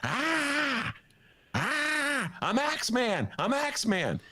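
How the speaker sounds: high, hammed-up battle cries; imitating Jack Nicholson. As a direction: pump yourself up like a crazed actor. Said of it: "he was jumping up and down".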